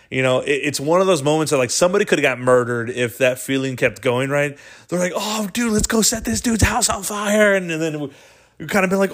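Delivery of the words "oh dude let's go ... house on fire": high, giddy dare; hyped-up friend. Retold or imitated. Friend hyping the gang to go further, high and giddy. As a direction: egg your buddies on, wired.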